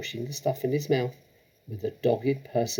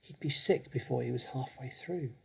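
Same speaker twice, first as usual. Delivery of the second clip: softer hushed reply; whisper; 2 semitones higher, 8 dB quieter than usual. Acted. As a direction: keep it hushed to your friend. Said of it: "Lavender whispered back".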